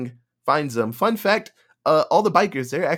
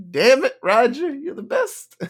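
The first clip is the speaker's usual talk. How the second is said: mock anguish; 10 semitones higher, 4 dB louder than usual. His own reaction to the death.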